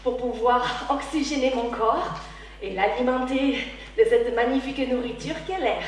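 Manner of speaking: ordinary talk, nothing marked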